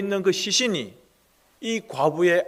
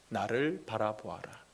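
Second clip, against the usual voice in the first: hushed whispered command; 7 semitones lower, 12 dB quieter than usual. Acted. Jesus whispering to the widow to look at him. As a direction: breathe it as a secret call.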